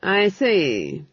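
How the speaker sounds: coldly. A narrator's reading. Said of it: "he said coldly".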